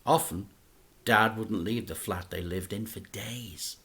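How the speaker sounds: ordinary delivery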